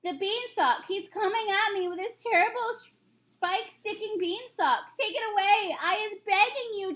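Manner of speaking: high, pitiful pleading; begging. Acted. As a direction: beg in terror. Giant begging Jack for mercy.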